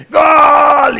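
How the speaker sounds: a sudden, very loud and high cry of "gol"; goal shout. Pretend. Fan watching the América match yells at the goal. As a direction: erupt like a fan seeing their team score.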